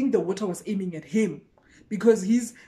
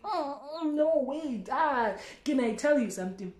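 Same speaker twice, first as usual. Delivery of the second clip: higher, softer whimpering protest; mock distressed; 6 semitones higher, 3 dB quieter than usual. Narrator squirming away from the guy, whimpering. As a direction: plead in a fake panic. Crying sound on lines.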